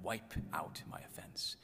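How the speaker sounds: slow, near-whispered prayer; hushed savoring. Acted. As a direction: linger softly over each word. Savors a line he loves.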